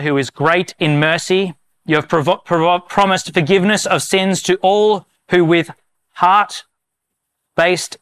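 slow, measured prayer of confession, pausing often. Real, not acted. Pastor leads the congregation in confession, slow and solemn. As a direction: lead the church through each line, earnest and unhurried. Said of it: "I'm gonna read it slowly".

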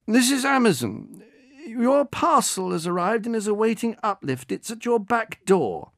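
cheery delivery man. Acted. Delivery man greeting the old woman on the phone, cheery. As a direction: put on a bright call-centre manner. Bernard poses as Amazon.

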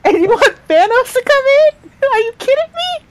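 high, stunned disbelief; incredulous. Real, not acted. Disbelief at the plan.

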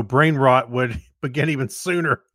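ordinary delivery

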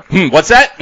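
words through laughter; giggling while asking; laughing. Real, not acted. Laughing at the spoiler threat.

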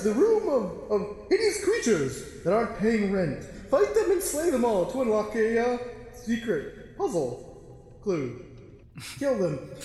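high, put-on voice announcing the quest; quest-giving figure voice. GM voicing a stranger who sends the party in, high. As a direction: pitch it way up like a fussy quest giver.

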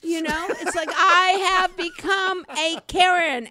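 loud, high laughing confession; laughing admission. Real, not acted. Admitting to the host she became a Karen, laughing. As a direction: laugh at yourself as you admit it.

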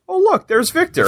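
high, mock-surprised Oh look; sarcastic high-pitched discovery; mock surprise. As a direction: squeal it like a sudden discovery. Mock Logan noticing Victor.

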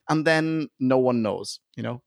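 ordinary delivery

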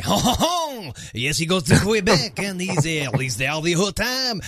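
playful French Canadian accent, hammed up. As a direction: put on a jokey Quebec accent. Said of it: "with a French Canadian accent".

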